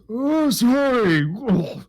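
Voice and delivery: high, loud, slow creature apology; apologetic giant. Pretend. Giant apologises to the dangling drow, high and drawn out. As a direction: drag out a dopey sorry. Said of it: "he goes".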